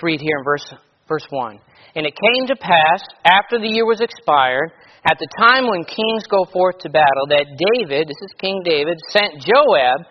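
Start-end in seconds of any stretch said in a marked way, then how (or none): 1.9-8.1 loud, measured King James reading voice; scripture reading. Acted. Preacher reads scripture aloud. 9.1-10.0 loud, measured King James reading voice; scripture reading. Acted. Preacher reads scripture aloud.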